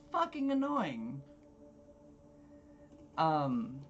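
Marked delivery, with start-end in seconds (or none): 0.1-1.2 high-pitched exasperated aside. Real, not acted. Server griping about demanding diners, high-pitched exasperation.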